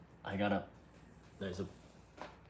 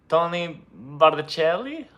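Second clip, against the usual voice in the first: slow, higher Italian name; Italian accent; 5 semitones higher, 3 dB louder, slower than usual. Pretend. Editor wonders whether his name is a soup, Italian accent. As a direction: savor the name like a dish. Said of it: "Is that a soup?".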